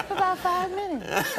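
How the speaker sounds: high, slow, drawn-out answer; reply in a leisurely falsetto; Michael impression. Michael answers Chris in the movies, high-voiced.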